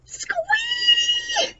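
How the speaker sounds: very high shrieking fan squeal; squeal of joy. Acted. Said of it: "These are squeals of joy".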